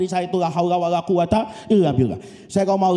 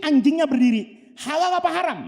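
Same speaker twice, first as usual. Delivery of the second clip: usual speaking voice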